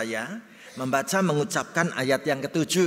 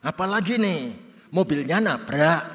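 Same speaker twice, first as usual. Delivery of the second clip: suspicious reply; 4 semitones higher, 6 dB louder than usual. Retold or imitated.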